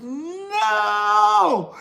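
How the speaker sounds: high-pitched mock scream of no. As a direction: shriek no in alarm. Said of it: "she screamed out".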